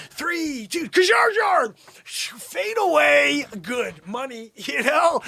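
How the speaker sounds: high, excited shouting countdown; buzzer-beater hype. Pretend. Imagined game, counting down the buzzer beater, excited shouting. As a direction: hype the last-second shot.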